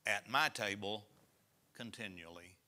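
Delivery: solemn pulpit reading; scripture reading. Acted. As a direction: read the verse out to the congregation. Reads scripture aloud.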